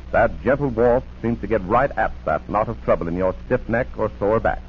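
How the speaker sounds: ordinary delivery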